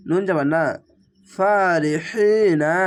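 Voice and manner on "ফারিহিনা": ফারিহিনা drawn out very slowly, each vowel stretched; slow drawn recitation. Shown as a demonstration. Teacher recites the word slowly for the class. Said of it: "এক আলিফ টেনে পড়বেন".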